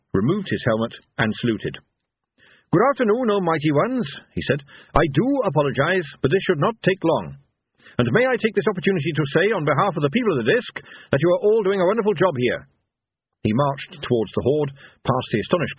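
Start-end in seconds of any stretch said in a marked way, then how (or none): 2.7-4.0 put-on higher, polite young officer's voice; Captain Carrot. A narrator's reading. Carrot greets the gods. 4.9-12.7 higher, brisk, polite officer; Captain Carrot. A narrator's reading. Carrot addresses the gods.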